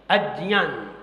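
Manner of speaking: अज्ञान said as "ad-jnyaan", with j; corrected pronunciation. Shown as a demonstration. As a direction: say अज्ञान with j plus nasal y, not g. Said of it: "आप सही पढ़ेंगे". (अज्ञान को "अज्-ज्यान" जैसा बोलते हुए)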